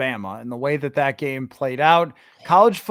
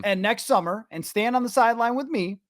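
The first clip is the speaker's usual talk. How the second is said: mock-indignant call-out; 7 semitones higher, faster than usual. Real, not acted. Host tells Cleveland reporters to learn practice, indignant call-out. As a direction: call them out in a raised, exasperated pitch.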